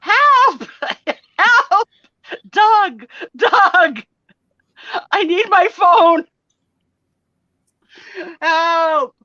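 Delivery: high, loud, hammed-up panicked yelling; overacted screaming for her husband Doug; dramatic cry for help. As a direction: shriek for help like a dramatic Italian in crisis. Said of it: "so Italian, so dramatic".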